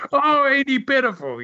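high, sneering mock sympathy; mocking pity. Wrestler mocking the crying rival, high mock pity. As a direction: jeer at the sobbing rival in a high voice. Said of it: "he's really laughing at him like".

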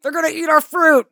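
high, loud comic alarm; mock panicked cry. Villager alarmed by the vacationers, loud mock panic. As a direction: shout it in pretend panic.

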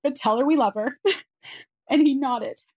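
high, tear-choked storytelling; tearful. Real, not acted. Mother telling of a relative dying on her daughter's due date, tearful. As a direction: keep going while fighting back sobs. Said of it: "I still cry when I tell that part".

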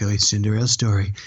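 lightly voiced mock sports announcer; a hint of a hushed golf commentator. Host quoting the caddy playing TV announcer, mild mock announcer. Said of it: "the announcer on the sports channel".

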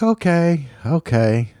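a high, resigned "okay, okay"; mock giving in. Answering his wife's wish for a show with a story, in mock surrender. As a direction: give in to the wife's request with a sigh of surrender. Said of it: "I'll be like".

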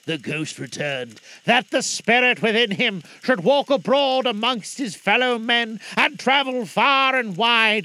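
loud, lamenting phantom; mournful ghost voice. Acted. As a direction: wail the doom like a chained spirit. Ghost's doom speech.

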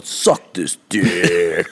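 mock yell, drawn out slowly; imitated slow-motion heckle; retold crowd yell. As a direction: stretch it like a chanting crowd, without real volume. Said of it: "them yelling at everyone going".

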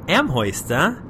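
badly faked Boston accent. Teasing college girls about UMass Amherst, badly faked Boston accent. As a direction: attempt a Boston accent and miss, landing near the Bronx. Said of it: "Was that supposed to be a Boston accent?".

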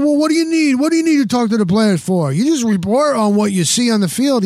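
high, pushy imitation of critics; mock indignant challenge. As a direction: mimic a dismissive know-it-all objecting. Acts out dismissive critics of reporters.

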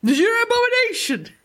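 sudden high-pitched outburst; shouting. Real, not acted. Podcast host rebuking co-host, shouting. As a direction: shout it in mock outrage, pitch leaping up. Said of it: "all of a sudden I'm shouting".

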